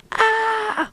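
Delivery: very high, loud shriek; piercing high scream. Acted. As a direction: scream as the bride loses it. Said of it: "Elle crie".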